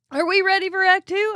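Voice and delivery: high, loud theatrical announcer call; showman announcement. Acted. Host announcing the next segment to viewers, theatrical. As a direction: hype up the viewers for the next act.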